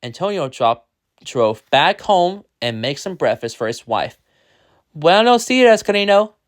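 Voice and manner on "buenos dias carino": high, loud greeting falling at the end; husband's greeting. A narrator's reading. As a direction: greet the wife brightly, pitch dropping off. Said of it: "he said".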